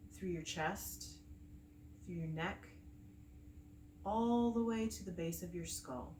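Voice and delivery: calm meditation guide with long pauses between phrases; unhurried, soothing breathwork instruction; guided meditation. Acted. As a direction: gently lead them through the breathing, leaving space after each phrase.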